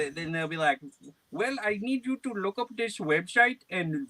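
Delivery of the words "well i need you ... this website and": high, put-on caller voice; imitated scam caller. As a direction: mimic the scammer giving instructions, pitched up.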